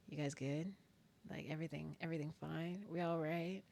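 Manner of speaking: very soft, careful questions; tentative checking in. Retold or imitated. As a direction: check gently that nobody is upset. Retold anxious checking on friends.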